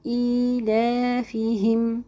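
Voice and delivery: ایلافیہم with the long vowels drawn out slowly; elongated recitation. Shown as a demonstration. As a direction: hold each madd two beats. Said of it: "دو دو حرکات کھینچنا ہے". (دو حرکہ کھینچ کر پڑھیں)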